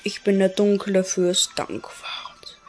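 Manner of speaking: whispered dark lord boast; villain whisper. Acted. Dark lord introducing himself to the townsfolk, whispered. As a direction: breathe it out like a scheming villain.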